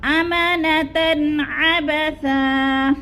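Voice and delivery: अमानतन अबसा slow, the ऐन said clearly, no nasal ghunna; slow recitation. Shown as a demonstration. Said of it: "ऐन हरूफ हल्की में से आ गया है". (इजहार हल्की के साथ धीरे पढ़ना)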